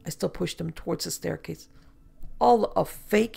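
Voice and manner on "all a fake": wheezed. Acted. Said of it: "he wheezed".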